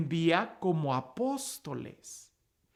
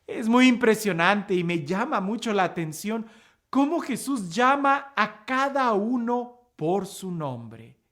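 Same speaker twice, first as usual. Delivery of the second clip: ordinary talk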